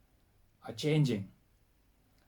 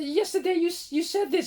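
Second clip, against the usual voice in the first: much higher, louder incredulous objection; insistent protest; 7 dB louder than usual. Retold or imitated. Researcher challenging the man's denial, high-pitched insistent protest. Retold argument, insisting on yesterday.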